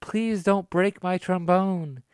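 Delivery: a hint of desperate pleading, drawn out slowly; mock pleading. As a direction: plead like a grown man about to lose his trombone. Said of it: "and he's begging".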